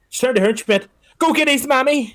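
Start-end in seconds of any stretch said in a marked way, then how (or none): 1.2-2.2 high, hurried command; urgent order. Retold or imitated. Nurse ordering the trainee to fetch the mother, high and urgent. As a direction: send the trainee running.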